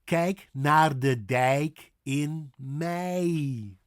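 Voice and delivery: slow Kijk naar de dijk, word by word; stretched ei in kijk, dijk and May; say-along model. Shown as a demonstration. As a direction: lead learners through kijk and dijk slowly. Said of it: "Sit with me".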